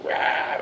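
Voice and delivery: ordinary delivery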